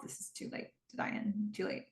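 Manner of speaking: whispered warning to a character; whispered aside. Real, not acted. Viewer warning Diane on screen, in a whisper. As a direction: breathe it like a secret aside.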